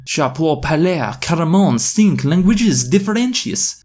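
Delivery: high, hammed-up French exclamation; shouted French. Tumas confessing to the crowd, shouting in French. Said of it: "Tumas shouted".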